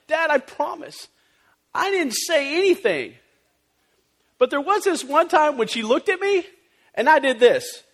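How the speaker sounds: put-on son's high innocent kid voice; little boy's voice. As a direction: raise the voice into a small boy insisting. Son explains to dad.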